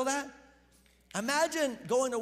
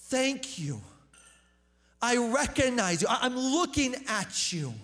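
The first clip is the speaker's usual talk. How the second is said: louder, emphatic declaration of thanks; shouted thanks; 2 semitones higher, 5 dB louder than usual. Retold or imitated. Healed leper shouts thanks to Jesus, emphatic raised voice. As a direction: call it out firmly to the one being thanked. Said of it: "he shouts out and goes".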